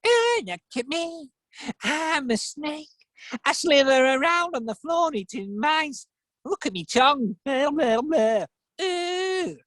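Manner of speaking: high, mocking imitation of a snake; complaining eagle. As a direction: sneer in a high-pitched eagle voice. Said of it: "the eagle complaining about the snake".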